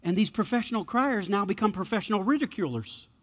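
ordinary talk, nothing marked

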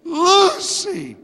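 high, drawn-out calls; calling out. Retold or imitated. Pastor calling for the lost dog on his bicycle, high and loud. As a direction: call the dog's name across the neighborhood. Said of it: "calling out".

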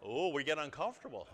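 ordinary talk, nothing marked

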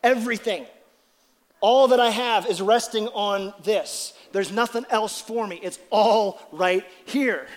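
loud, slow and wholehearted, slowing to a drawn-out end; acted surrender. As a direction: lean your full weight on every word. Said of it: "taking your legs and going".

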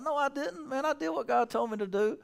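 high, lightly voiced Saul in denial; voicing Saul denying. Saul denies to Samuel, mock indignant. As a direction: protest innocence as Saul.

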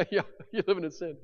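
quick, soft, dismissive agreement; mock agreement. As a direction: brush it off casually. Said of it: "are you silent?".

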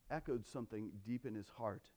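usual speaking voice

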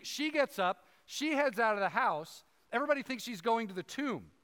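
ordinary delivery

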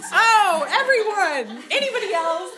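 high, loud delighted call; real delighted laughing call; excited calling out. Real, not acted. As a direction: call to the room while laughing.

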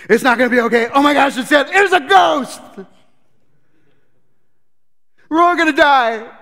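high, loud panicked wail; hammed-up high scream that we're all doomed. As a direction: break into full mock panic. Disciple panics in storm.

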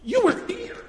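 very high, bright encouraging coaxing; Jesus coaxing Peter with high encouragement. Retold or imitated. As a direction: cheer him back on warmly. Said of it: "he's looking at him going".

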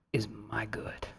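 barely there, breathed whisper; whispered confession of faith; whispered close. Real, not acted. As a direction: let it fall to a whisper to close. Closing hushed confession.

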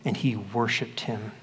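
ordinary talk

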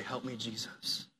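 soft, low whispered-down help me, Jesus; hushed plea of help me, Jesus; quiet plea. Retold or imitated. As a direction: breathe it out as a humble prayer. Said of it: "reach up and say".

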